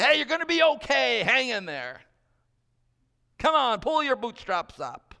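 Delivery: loud, high pep-talk holler; yelled encouragement. Acted. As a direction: yell it out like a coach. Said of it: "just yell out at you".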